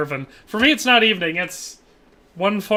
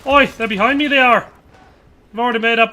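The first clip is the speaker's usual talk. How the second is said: loud and higher, sudden alarm; alarmed callout; 4 semitones higher, 6 dB louder than usual. Real, not acted. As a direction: warn the team, enemies right behind you. Enemies spotted behind him.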